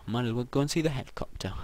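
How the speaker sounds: usual speaking voice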